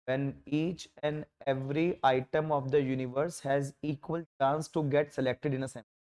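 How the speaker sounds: very slow, word by word definition; slow dictation. Shown as a demonstration. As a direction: spell it out slowly so students can write. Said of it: "धीरे धीरे बोलूंगा". (बहुत धीरे धीरे बोलकर लिखवाना)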